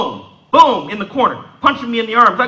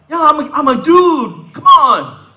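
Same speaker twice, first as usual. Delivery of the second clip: high, loud, drawn-out objection; mock-indignant, pitched up and stretched out; indignant protest; 8 semitones higher, 4 dB louder, slower than usual. Teenage boy mistaken for ma'am at the store, indignant.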